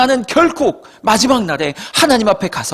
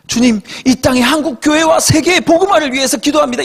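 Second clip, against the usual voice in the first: pious formal prayer; 8 semitones higher than usual. Retold or imitated. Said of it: "이런 기도만 하고 있나요?".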